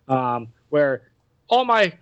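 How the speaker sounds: normal talking voice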